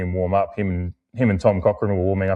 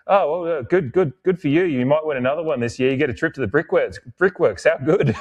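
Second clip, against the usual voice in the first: gleeful sarcastic taunt, pitched up high; mocking fan; 8 semitones higher than usual. Port fan taunting the Norwood fan, high gleeful sarcasm. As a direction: sneer it out, high and delighted.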